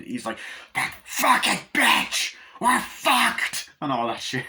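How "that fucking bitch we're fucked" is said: much higher and louder, furious screaming; annoyed father's outburst. Retold or imitated. Annoyed father screaming at his family, furious shout. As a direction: explode at the whole situation, shrieking curses. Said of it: "when he gets annoyed".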